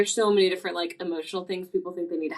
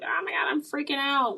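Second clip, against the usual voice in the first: mock freaking out; 8 semitones higher than usual. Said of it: "when we freak out in life".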